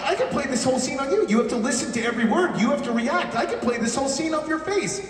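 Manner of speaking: imitated director, high-pitched and insistent; retold director's pep talk. Director urging young actor to listen and react, imitated high insistence. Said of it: "And he goes".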